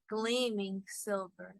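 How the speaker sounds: ordinary delivery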